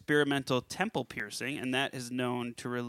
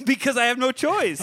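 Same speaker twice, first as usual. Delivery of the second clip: laughing; 11 semitones higher, 10 dB louder than usual. Real, not acted. Laughs through his own joke.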